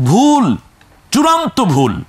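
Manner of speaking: high-pitched, forceful exclamation; emphatic correction. Real, not acted. Teacher emphatically condemns the misreading. (উঁচু গলায় জোর দিয়ে ভুল ধরা)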